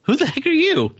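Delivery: high, startled comic-book hero voice; puzzled Ghost Rider. Retold or imitated. Ghost Rider, baffled, asks the vampire who he is. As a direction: pitch it way up, baffled at the stranger. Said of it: "Ghost Rider just notices Morbius and is like".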